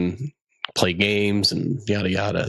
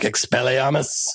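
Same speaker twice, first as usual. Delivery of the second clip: wizard spell; 5 semitones higher, slower than usual. Pretend.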